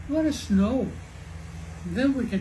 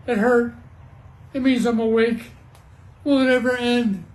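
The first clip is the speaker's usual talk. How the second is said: loud, high storybook wail; distressed cry; 4 semitones higher, 7 dB louder than usual. A narrator's reading. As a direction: cry out in dismay over the storm. Character wailing in the storm.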